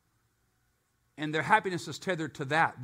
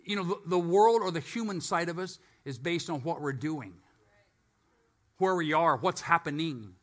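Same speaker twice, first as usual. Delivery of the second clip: ordinary talk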